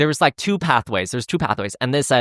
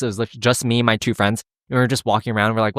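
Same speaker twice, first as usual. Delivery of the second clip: usual speaking voice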